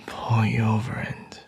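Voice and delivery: usual speaking voice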